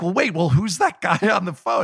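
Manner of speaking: high, puzzled voice suddenly cutting in; baffled question. Retold or imitated. To friends, how we reacted to the phone call: high and baffled. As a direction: ask it like you can't work out who's calling. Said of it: "we were like".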